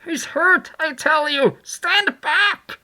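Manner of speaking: very high, loud urgent command; urgent shouting. A narrator's reading. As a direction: shout the crowd back urgently. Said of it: "cried the muffled voice of Kemp".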